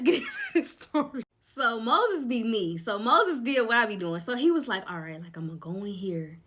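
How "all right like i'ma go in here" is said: softly whispered, lower, to himself; whispered rehearsal. Retold or imitated. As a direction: mutter it under your breath like rehearsing in your head. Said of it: "He was just playing over in his head".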